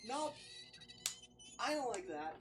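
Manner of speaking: normal talking voice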